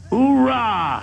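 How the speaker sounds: high, punchy military cheer; gung ho. Pretend. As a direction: bark it like a Marine. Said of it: "drop an ooh-rah real quick".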